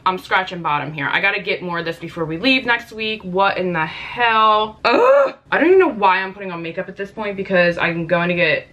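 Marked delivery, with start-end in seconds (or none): 3.2-4.8 slow exasperated. Real, not acted. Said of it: "exasperated at product". 4.8-5.3 a sudden shrill groan of disgust; sharp high frustrated ugh. Real, not acted. Disgust at empty product.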